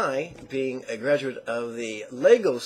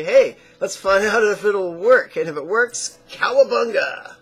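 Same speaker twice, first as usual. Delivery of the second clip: loud, higher, excited cheer; gung-ho enthusiasts; 6 semitones higher, 10 dB louder than usual. Acted. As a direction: play the eager daredevils, building to a whooped cowabunga. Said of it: "other people will say".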